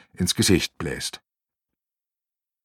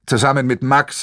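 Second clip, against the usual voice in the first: plain reading voice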